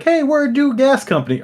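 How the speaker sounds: very high put-on greeting; high mock sales pitch; pretend salesperson. Imagined salesperson at the door.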